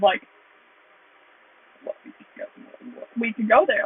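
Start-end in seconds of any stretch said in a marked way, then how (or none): 1.8-2.4 barely audible, voiceless aside to a friend; hushed whisper. Retold or imitated. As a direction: whisper it as a secret shared idea. Acts out a shared look.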